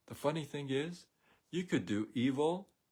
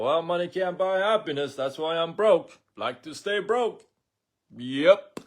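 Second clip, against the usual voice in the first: higher, louder mocking voice of a broke person; 6 semitones higher, 7 dB louder than usual. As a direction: sneer it like a smug broke guy. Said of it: "tell other people".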